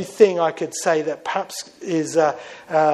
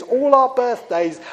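normal talking voice

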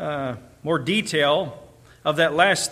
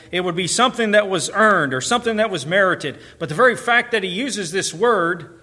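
raised, driving sermon delivery; forceful preacher, pitched up; emphatic preaching; 3 semitones higher, 4 dB louder than usual. Real, not acted. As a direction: hammer the point home with insistent repetition.

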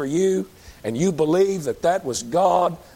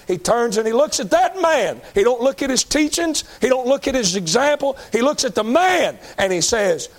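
fervent preaching; 8 semitones higher, 3 dB louder than usual. Real, not acted. Preacher builds to climax.

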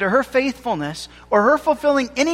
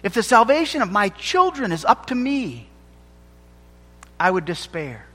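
normal talking voice

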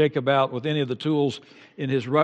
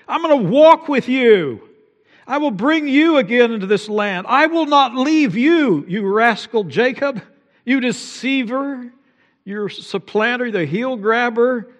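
fervent proclamation; 8 semitones higher, 5 dB louder than usual. Acted. Preacher voicing God's promise to rascal Jacob, fervent. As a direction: proclaim it with mounting fire.

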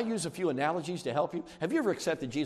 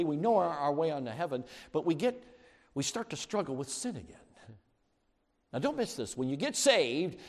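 ordinary talk

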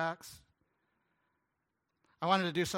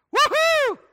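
loud, high celebratory cheer; a burst of pure excitement; joyful whoop; 19 dB louder than usual. Shown as a demonstration. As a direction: whoop with delight over giving. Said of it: "you know how you should feel?".

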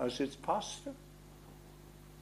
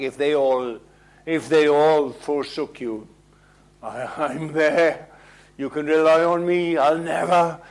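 emphatic vow; 6 dB louder than usual. Acted. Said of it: "Peter was most emphatic".

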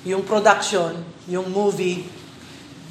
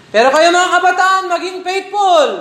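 raised preacher's plea; exhorting; 9 semitones higher, 7 dB louder than usual. Real, not acted. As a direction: urge them hard to stay faithful.

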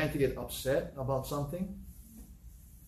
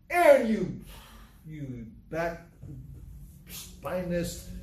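mock scolding; slower than usual. Acts Moses rebuking Aaron.

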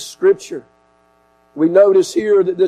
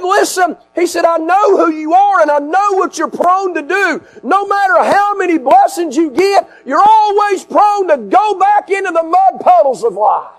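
full-force preaching; 10 semitones higher than usual. Acted. Preacher voices God and the complaining believer, full-force preaching. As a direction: build to a high, insistent preaching pitch. Preaches God's rebuke, building.

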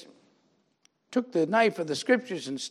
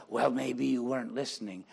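pastor's funny voice; 7 semitones lower, 6 dB quieter than usual. Retold or imitated. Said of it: "he had a funny voice".

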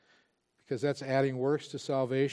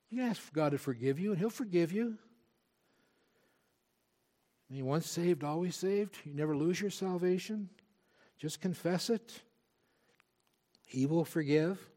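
tempter reassuring; 3 semitones higher than usual. Retold or imitated. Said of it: "And the tempter comes like that".

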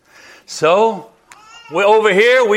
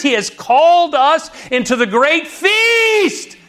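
preaching; 5 semitones higher than usual. Acted. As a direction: preach it, climbing to a shout. Preaching builds to a shout.

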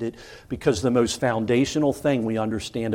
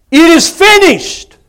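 much higher, loud ringing call on finished; final cry; 13 dB louder than usual. Retold or imitated. Dying man's last cry from the cross, ringing out. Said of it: "the last thing you would have heard him cry out".

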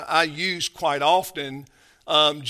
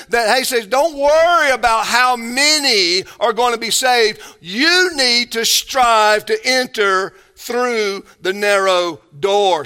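louder, higher preaching push; fervent preaching; 5 semitones higher, 6 dB louder than usual. Acted. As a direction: drive the point home from the pulpit. Preaching Jesus' command.

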